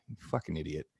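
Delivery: muttered insult. Retold or imitated.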